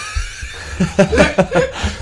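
loud unvoiced laughing burst; breathy laugh. Real, not acted. Laughs at cigar story.

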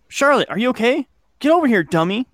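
high-pitched call across a distance. A narrator's reading. Kid checking on Charlotte in the woods, calling out.

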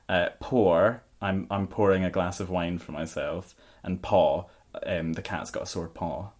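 ordinary talk, nothing marked

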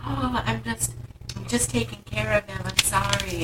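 put-on high, hushed apologetic girlfriend voice; Kitty. Girlfriend apologizes to the driver overheard, mock meek softness. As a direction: imitate a meek, soft apology. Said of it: "we overhear Kitty and Wayne's conversation".

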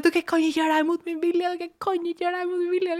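high, pleading protest; mock desperate protest. To the staff wheeling her, high desperate mock protest.

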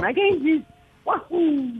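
high, booming sing-song cartoon plumber; Super Mario voice. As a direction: greet the kid as a bouncy Italian hero. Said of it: "Can you say a little something to Java Charles?".

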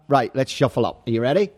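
ordinary delivery

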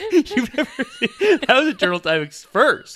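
loud, high words through laughter; laughing hard. Real, not acted. Podcaster marking a co-host's first on-air sneeze, laughing. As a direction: crack up while getting it out.

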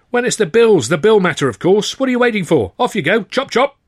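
loud, high-pitched impatient orders; losing his temper. A narrator's reading. Harassed manager orders supposed errand boy off, losing his temper. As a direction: send him packing as a harassed boss. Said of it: "losing his hair and his temper".

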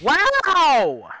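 loud, high, drawn-out wow; big rising exclamation of disbelief; shocked wow. Real, not acted. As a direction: react scandalised, stretching it out. Shocked at a friend's remark.

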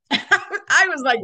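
laughing through the words. Real, not acted. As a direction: say it while laughing. Laughing while telling.

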